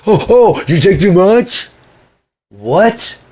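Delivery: high, loud mock-concerned exclamation; mocking someone's alarm. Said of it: "like any person would".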